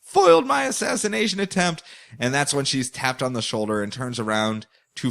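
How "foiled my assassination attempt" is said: much higher hammed-up villain voice; villainess. Foiled villainess on tower, hammy high curse. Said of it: "Scorpiana's like".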